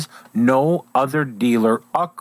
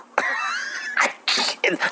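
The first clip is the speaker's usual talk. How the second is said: soft laugh; 6 dB quieter than usual. Real, not acted. Laughs after singing.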